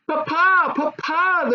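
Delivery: put-on high, eager child calling out; joyful cry. A narrator's reading. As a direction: call to her father with joy. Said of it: "the princess cried".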